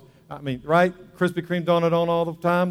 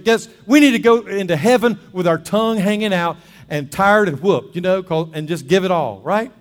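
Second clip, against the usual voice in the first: raised, louder preacher's exhortation; impassioned preaching; 4 semitones higher, 7 dB louder than usual. Real, not acted. Preacher urging the congregation to give it all, impassioned. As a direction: preach it high and hard.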